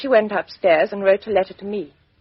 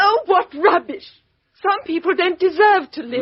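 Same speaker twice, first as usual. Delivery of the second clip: high, indignant retort; scornful outrage; 9 semitones higher than usual. Acted. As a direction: spit back the contempt. Woman rejects detective's judgment.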